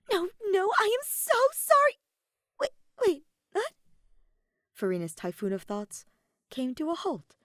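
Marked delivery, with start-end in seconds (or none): 0.1-2.0 high, loud, frantic pleading; escalating high-pitched panic; panicked apology. A narrator's reading. Flustered Farina cuts in apologising. 2.6-3.7 high, loud double take; flustered, high-pitched disbelief; startled bewilderment. A narrator's reading. Farina stops short, bewildered.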